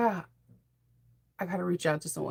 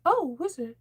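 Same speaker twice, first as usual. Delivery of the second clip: high, wide-eyed surprised Dorothy voice; Dorothy impression; 8 semitones higher than usual. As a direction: play Dorothy, amazed and much higher. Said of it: "Didn't she always sound surprised?".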